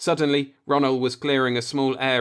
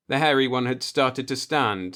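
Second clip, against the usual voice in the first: plain reading voice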